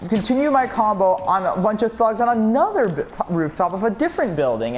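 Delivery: really carried away by the game; pumped up. Real, not acted. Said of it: "I'm getting pumped up right now".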